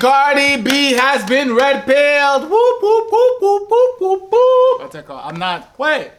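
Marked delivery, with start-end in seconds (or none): 0.0-2.5 loud, high, drawn-out proclamation; mock announcement. 2.5-4.8 very high, loud repeated whooping; imitated alarm whoops. Imitated alarm after the announcement.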